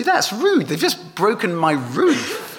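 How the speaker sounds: high, laughing mock outrage at the broken roof; indignant British homeowner. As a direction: squeal it out, half laughing, scandalised. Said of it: "if you're British, you'll say".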